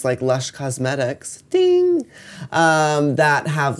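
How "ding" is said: high sung ding; ding sound. Real, not acted. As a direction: chime it like a bell. Sound-effect word mid sentence.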